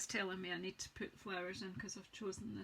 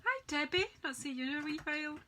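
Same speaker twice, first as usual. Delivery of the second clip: excited greeting; 6 semitones higher, 4 dB louder than usual. Real, not acted. Greets a viewer on the livestream.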